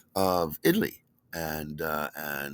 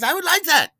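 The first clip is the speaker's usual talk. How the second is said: high, loud, charmed acceptance; a young Sicilian woman accepting a date; her accented reply; 11 dB louder than usual. Retold or imitated. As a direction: answer him like a delighted Italian girl. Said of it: "She says".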